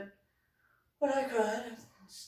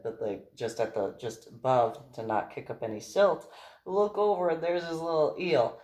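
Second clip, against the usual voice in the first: ordinary talk, nothing marked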